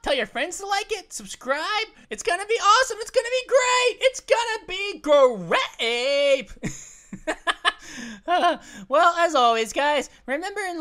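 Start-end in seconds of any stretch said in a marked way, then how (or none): 2.1-4.0 high, loud promotional gush; excited hype. Acted. Gamer selling his channel to viewers, excited hype. 4.0-6.5 loud, very slow GREAT, hugely stretched; drawn-out hype. Acted. Gamer capping his subscribe pitch, slow stretched "great".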